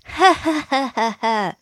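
high, teasing ha ha; mocking laugh.

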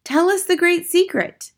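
high, pleading call from the crowd; eager witch audience. Acted. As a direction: beg the leader to reveal it. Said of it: "they called out".

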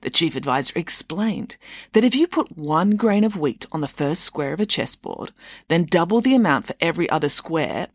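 usual speaking voice

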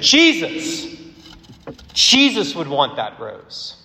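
high, loud outburst that eases off; acted-out defiant exclamation; impassioned cry. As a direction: cry out the name, then slow the answer emphatically. Minister's outburst answering the head guy.

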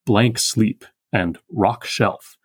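"blank sleep" and "rock shelf" each word weighted, very slow; heavy stresses. Shown as a demonstration. Teacher showing heavy iambs, every syllable given full weight. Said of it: "very heavy iambs".

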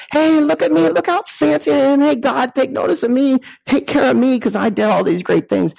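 high, hammed-up boasting voice; boastful show-off. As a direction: play a vain person demanding God's notice. Boastful person voiced, far timbre.

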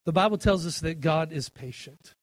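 usual speaking voice

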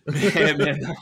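laughing, high and loud. Real, not acted. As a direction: laugh it off, real. Laughs off his own joke.

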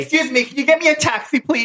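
high, prim voice pleading for a taxi; Brenda reenactment. Acted. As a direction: play Brenda, high and clipped upset. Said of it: "me and Robert are gonna reenact it".

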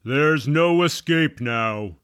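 lightly voiced official over a loudhailer; deep-voiced man. A hint of a deep-voiced man addressing the trapped pair. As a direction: announce it firmly. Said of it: "said a deep-voiced man".

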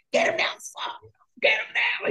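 hissed under the breath, then shot way up high; urgent whisper that bursts into a high yell; retold frantic orders. Telling the restaurant staff to get the paramedic, urgent whisper then high yell. Yelling at staff during seizure.

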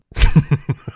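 sudden loud burst of laughter. Real, not acted. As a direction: crack up at your own joke. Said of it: "and I start laughing".

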